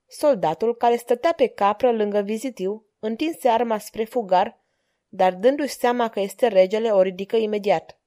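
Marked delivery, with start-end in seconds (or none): none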